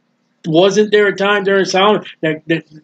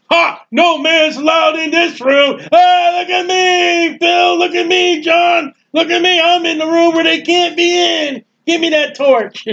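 mocking invader; 10 semitones higher than usual. Preacher voicing Babylonians ridiculing the temple, high jeering shout. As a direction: crow over the holy room like a gloating invader.

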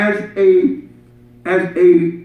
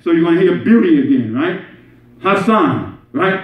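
usual speaking voice

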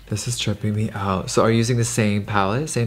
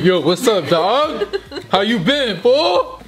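hammy street greeting; 10 semitones higher, slower than usual. Pretend. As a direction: greet like a goofy homie. Goofy greeting in put-on voice.

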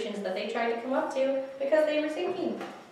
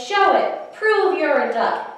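challenging mother; 8 semitones higher, 8 dB louder, slower than usual. Acted. Mama challenges the fox.

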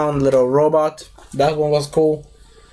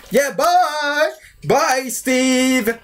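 high, singsong put-on goodbye; cheerily ditch him in a high voice; hammed-up bye-bye; mock callous farewell; 6 semitones higher, much slower than usual. Acts out escapees abandoning someone.

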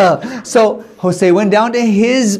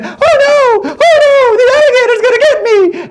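hammed-up terrified squeal, much higher and louder; fake scared; 4 dB louder than usual. Jose fakes fear of the alligator, high hammed-up squeal. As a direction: pretend panic, wailing and laughing. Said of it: "started acting like he was really scared".

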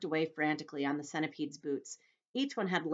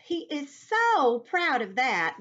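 high, loud, slow ladybug voice; 9 semitones higher, 9 dB louder, much slower than usual. Acted. Ladybug talking to James about centipede, high and drawn-out.

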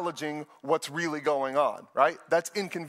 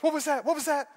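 high-pitched mock-frightened voice; scared girlfriend; 10 semitones higher than usual. Scared girlfriend to her boyfriend after a noise, high and frightened. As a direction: play a spooked movie girlfriend. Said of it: "The scared girlfriend goes".

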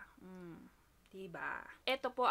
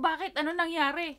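louder, higher anxious asking; worried questions; 5 semitones higher, 8 dB louder than usual. Retold or imitated. Asking her crying sister, louder worried. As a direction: press her sister with worry. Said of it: "Sabi ko".

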